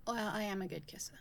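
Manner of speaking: quick, soft whispered boast; playful whisper. Acted. Bragging to partner after kissing, playful whisper. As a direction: whisper it with a smug tease.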